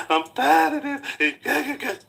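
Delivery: slow, high, whiny mock sob through a laugh; high, drawn-out mocking whine; mock fake crying. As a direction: whimper it like a fake cry you can't keep up. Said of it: "You can't just talk".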